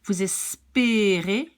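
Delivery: "espérez" slow, with closed é sounds. Shown as a demonstration. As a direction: hold the ay-like é in "espérez". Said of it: "It's more pronounced like E".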